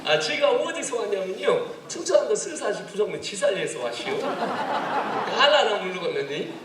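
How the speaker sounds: high, loud Chungcheong dialect; Chungcheong accent. Shown as a demonstration. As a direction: proclaim it in broad Chungcheong country talk. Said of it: "충청남도 스산시 부성면 지사리에서".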